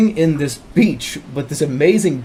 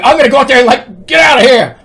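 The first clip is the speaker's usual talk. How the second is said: loud, high shouted threat; acted battle yell; 9 semitones higher, 8 dB louder than usual. Dreamer planning to scare the tiger with shears, yelling. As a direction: yell it as if charging out.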